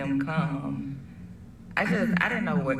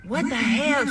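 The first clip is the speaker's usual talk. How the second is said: high, sharp exclamation; shocked mother; 9 semitones higher, 4 dB louder than usual. Retold or imitated. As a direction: blurt it out in disbelief. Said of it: "his mom is like".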